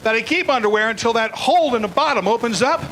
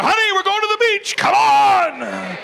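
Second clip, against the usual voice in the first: calling out; 10 semitones higher, slower than usual. Retold or imitated. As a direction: shout cheerfully to honey. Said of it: "I'll just tell her".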